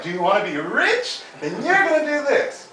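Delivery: high rising unsure voice; mocking upspeak. Shown as a demonstration. Coach shows how business coaches never talk, high. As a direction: parody the weak upstroke. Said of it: "It's never like".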